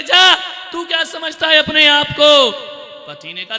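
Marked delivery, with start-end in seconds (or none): 0.0-2.5 high, loud scolding; angry shout. Acted. Wife telling off her husband, shouting angrily. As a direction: tell him off at full voice.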